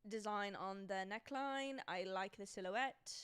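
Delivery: wobbly put-on British accent; mock British, carefully enunciated. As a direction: review it like an amateur British critic. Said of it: "I can't stick to a solid British accent".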